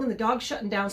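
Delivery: usual speaking voice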